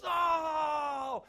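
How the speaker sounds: long, high, loud "oh"; imitated furious yell; loud outraged cry. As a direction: holler one drawn-out howl of dismay. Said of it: "like, loud".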